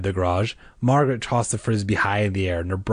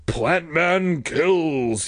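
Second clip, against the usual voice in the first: extremely slow, drawn-out battle cry; high, hammed-up Plant Man attack; mock monster cry; 7 semitones higher, much slower than usual. A narrator's reading. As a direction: stretch it out like a rampaging movie monster. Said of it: "he cried".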